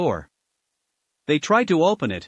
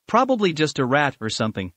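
ordinary delivery

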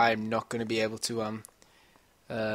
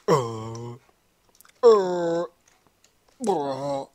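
exaggerated long groans; straining groans; 3 semitones higher, 5 dB louder, much slower than usual. Gamer pretends he desperately needs a crap, straining groans. Acting desperately needing the toilet.

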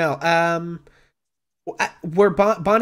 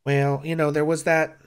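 kindly barmaid Bonnie. Acted. Bonnie reassures Mel about smoothing things over with Durnan, kindly. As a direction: soothe them in a homespun way. Game master voices Bonnie.

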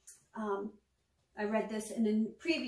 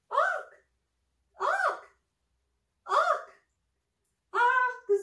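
much higher, loud bird calls; raven squawks; 6 dB louder, much slower than usual. Acted. Raven calling into the dark before sleep, high squawks. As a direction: call out like a raven at dusk.

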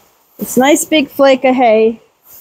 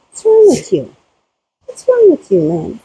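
mock scolding. Real, not acted. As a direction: tease her fondly. Teasing goat.

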